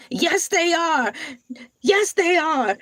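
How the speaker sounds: high squeaky voice for a little animal; cute animal voice. Acted. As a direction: speak like a tiny cute creature.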